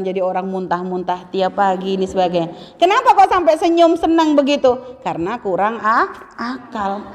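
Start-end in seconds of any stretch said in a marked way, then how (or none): 5.1-7.0 drops to a near-hushed teasing "kurang ak-, akal"; soft punchline. Shown as a demonstration. Preacher teasing the women listeners, hushed and playful. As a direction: lets "akal" fall away softly, like a playful secret.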